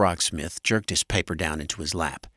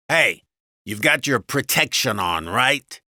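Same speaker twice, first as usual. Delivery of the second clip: loud, pitched-up husband; raised, nagging husband voice; bossy husband; 6 semitones higher, 5 dB louder than usual. A narrator's reading. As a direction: check on her brusquely. Husband checks on wife.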